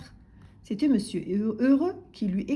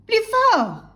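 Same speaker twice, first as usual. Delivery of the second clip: high, loud holler; shouted demand; 12 semitones higher, 11 dB louder than usual. A narrator's reading. Grocer who cannot hear customer, bellowing.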